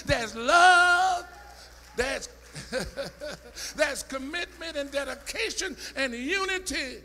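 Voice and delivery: loud, high, rising sermon cry; preaching at full force. Acted. Preacher shouting joy and peace to the congregation, full force. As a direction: preach it hard and let it ring.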